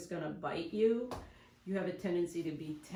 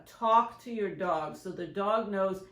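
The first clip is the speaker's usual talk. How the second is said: ordinary delivery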